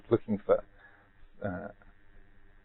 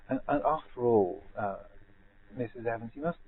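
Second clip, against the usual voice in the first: put-on posh accent; 7 dB quieter than usual. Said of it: "very frightfully posh accent".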